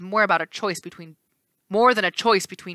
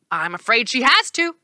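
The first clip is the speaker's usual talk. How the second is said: cheerful; 5 semitones higher, 5 dB louder than usual. Acted. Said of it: "the right face said cheerfully".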